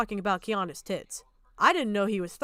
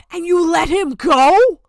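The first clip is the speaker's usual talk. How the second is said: loud, slow disbelieving shout; outraged yell; 5 semitones higher, 11 dB louder, slower than usual. Real, not acted. Streamer yelling at the people who let the predator go, outraged. Angry rant.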